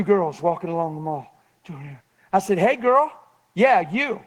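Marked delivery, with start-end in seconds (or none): 2.6-4.2 loud, high call-out; yelling across distance. Retold or imitated. Preacher hailing a stranger across the mall, yelling. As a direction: holler to someone far off. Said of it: "I yelled across the mall".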